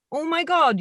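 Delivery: ordinary delivery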